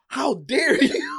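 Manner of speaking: high, slow, hammed-up offended cry; mock outraged Hulk. Hulk snapping at Thor's insult, hammed-up high cry. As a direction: play the Hulk as wounded and outraged. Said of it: "the Hulk started snapping".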